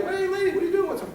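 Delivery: high, loud hail to a stranger; fireman calling out. Retold or imitated. Arriving firemen hail the frantic mother, high loud call. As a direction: call across to the lady.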